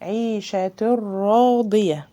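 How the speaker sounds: عيشة راضية slow, the tanween merged into a doubled ra; slow tajweed chanting; slow recitation. Shown as a demonstration. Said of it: "مفيش غنة".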